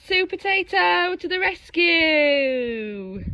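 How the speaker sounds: big slow hero fanfare voice; heroic announcement. A narrator's reading.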